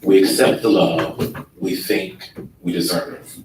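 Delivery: ordinary delivery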